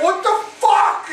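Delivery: high, incredulous outburst; alarmed protest. Retold or imitated. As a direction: re-enact shock mid-fight. His reaction mid-fight acted out.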